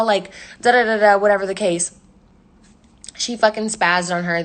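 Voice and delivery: ordinary talk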